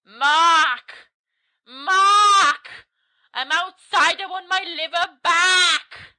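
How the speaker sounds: high, drawn-out ghostly wail; spooky calling voice. Acted. Voice outside demands its liver from Mark, high eerie wail. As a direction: call out eerily, stretching the last word. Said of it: "He hears a voice from outside".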